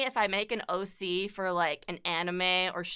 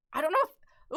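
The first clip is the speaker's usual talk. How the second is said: voice crack; 7 semitones higher than usual. Real, not acted. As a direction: voice cracks up high on "know". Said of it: "that was a sexy voice crack".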